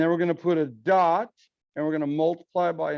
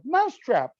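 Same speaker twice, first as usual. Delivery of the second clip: loud, high English-accent shout of the word; yelled in an English accent; 8 semitones higher, 4 dB louder than usual. Shown as a demonstration. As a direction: shout it like a British game ad. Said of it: "in an English accent".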